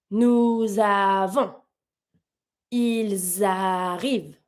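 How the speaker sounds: slow, drawn-out drill of each linked phrase; slow repeat-after-me. Shown as a demonstration. Teacher leading class in slow liaison drill. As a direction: model each phrase slowly for students to echo. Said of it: "Let's do it one more time together".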